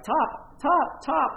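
high, loud repeated warning call; sharp, urgent shout of alarm; rafting guide yelling a warning. Retold or imitated. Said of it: "I hear him saying something like".

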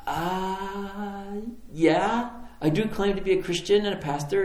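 high, stretched hesitant uh, then admitting; squirming, high-pitched retold reply; sheepish admission. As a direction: act out being caught out. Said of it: "this is what I was like".

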